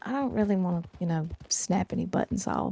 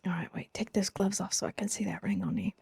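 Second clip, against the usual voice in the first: soft intimate whisper; 7 dB quieter than usual. Real, not acted. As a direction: whisper it close and tender. Intimate request to fiance.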